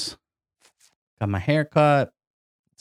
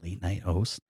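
low, soft late-night DJ voice; late night radio host; 7 semitones lower, 9 dB quieter than usual. Acted. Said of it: "I'm talking like a radio late night host".

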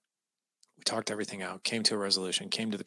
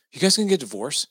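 son's alarmed question, higher and louder; retold fearful child; 6 semitones higher, 8 dB louder than usual. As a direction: imitate a worried kid, pitched up. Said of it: "he had this look of fear".

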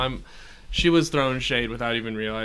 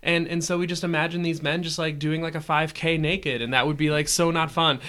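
lightly voiced mom, a bit higher and brighter in pitch; imitating his mom; 6 semitones higher than usual. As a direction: quote mom with raised pitch, half-mocking. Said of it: "and then went on to say".

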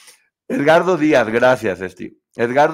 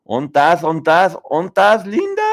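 playful calling; 6 semitones higher, 5 dB louder, slower than usual. Real, not acted. Calling out for Linda in chat.

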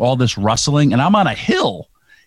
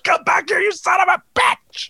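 mock yell. Yelling at departing car.

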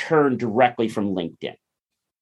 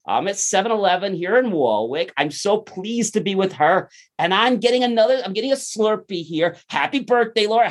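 higher, hyped-up promo voice for the camera; upbeat selfie video greeting; 6 semitones higher than usual. Acted. Filming a selfie with the store lady, hyped and high. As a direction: sell the store on a quick selfie video, bright and pumped. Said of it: "I'm like".

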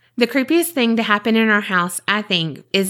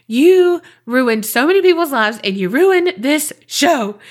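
angry outburst; 5 semitones higher, 5 dB louder than usual. Real, not acted. Angry rant at actor.

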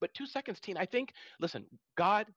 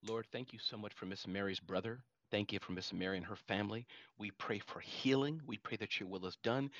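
soft, low prayer voice; 7 semitones lower, 4 dB quieter than usual. Acted. Host praying for a caller's sick brother, soft and low. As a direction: pray softly over the family.

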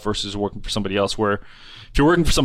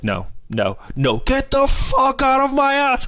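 high, strained mock rant; angry homeowner; 14 semitones higher, slower than usual. Homeowner throwing the salesman off his porch, high mock rage. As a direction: squawk like an outraged rich guy.

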